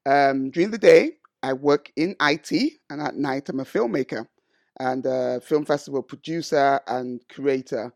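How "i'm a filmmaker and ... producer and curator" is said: normal talking voice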